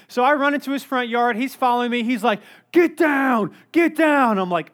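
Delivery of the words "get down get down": loud, higher barked command; pursuing neighbor shouting orders at the kid; shouted order. Retold or imitated. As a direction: yell it like a cop taking someone down.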